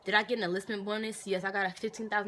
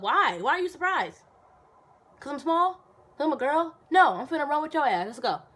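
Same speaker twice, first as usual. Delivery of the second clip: high, loud defiant challenge; defiant retort; 7 semitones higher, 8 dB louder than usual. Real, not acted. Answering runners who doubted her, high loud defiance. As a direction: throw it back at them, pitch way up.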